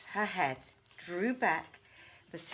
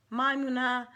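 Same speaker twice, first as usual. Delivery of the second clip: ordinary delivery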